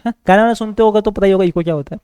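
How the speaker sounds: usual speaking voice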